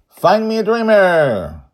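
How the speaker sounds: bright, high, loud call; happy shout. Acted. Young captain Joy shouting happily to her crew. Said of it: "Joy shouts happily".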